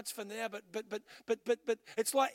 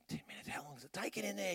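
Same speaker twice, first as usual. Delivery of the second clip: frustrated mutter; 9 dB quieter, faster than usual. Retold or imitated. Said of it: "I'm starting to get a little bit angry and frustrated".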